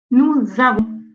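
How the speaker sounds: ordinary talk, nothing marked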